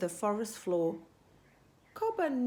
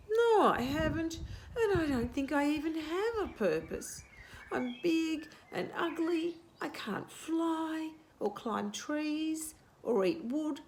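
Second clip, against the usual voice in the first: high, whiny moan; 7 semitones higher than usual. Acted. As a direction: complain like a sorry cassowary. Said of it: "Cobber moaned".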